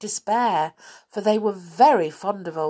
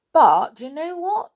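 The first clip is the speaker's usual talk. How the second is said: teasing question; 10 semitones higher, 7 dB louder than usual. A narrator's reading. Narrator teasing listeners.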